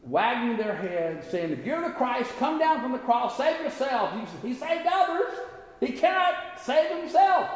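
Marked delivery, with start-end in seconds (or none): 1.5-7.5 high-pitched taunt, climbing as it goes; mocking. Crowd taunting Jesus on the cross, mocking. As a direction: jeer like a hostile crowd, voice pitched way up. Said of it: "people are mocking him".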